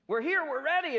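high, loud eager greeting; the crowd calling out, keen and ready. Acted. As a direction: greet him bursting with eagerness. Eager crowd greets tired Jesus.

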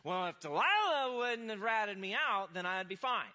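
Samson blaming. Retold or imitated. Samson blaming Delilah for his failure, loud whiny excuse. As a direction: shift the blame onto Delilah. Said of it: "can you almost hear Samson going".

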